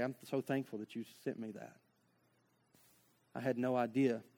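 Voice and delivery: soft grateful. Acted. Grateful friend quoted.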